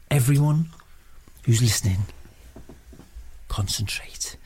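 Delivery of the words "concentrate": whisper. Acted. Medium guiding listeners.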